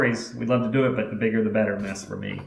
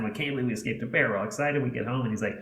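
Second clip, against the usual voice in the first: normal talking voice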